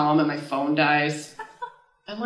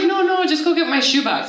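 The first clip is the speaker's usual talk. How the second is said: high, louder mock insistence; insistent; 9 semitones higher, 5 dB louder than usual. Sister ordering her to get a shoebox, insistent. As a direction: brush off the objection as a bossy sister would.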